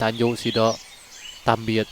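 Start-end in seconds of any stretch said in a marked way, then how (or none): none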